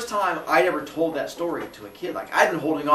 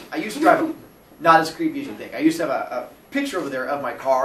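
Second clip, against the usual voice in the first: normal talking voice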